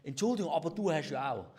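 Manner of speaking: mock apology turning into blame; grudging apology. Speaker shows how not to apologise, mock-defensive. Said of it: "man sagt".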